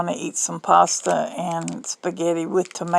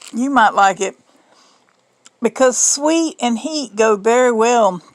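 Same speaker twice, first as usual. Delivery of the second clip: ordinary talk, nothing marked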